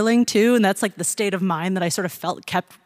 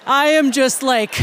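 ordinary delivery

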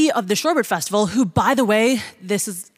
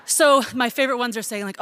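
normal talking voice

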